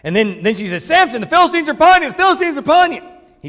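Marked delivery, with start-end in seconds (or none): 0.8-3.0 high-pitched mock cry of alarm; calling out. Delilah calling the sleeping Samson, high mock alarm. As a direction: call out to wake Samson.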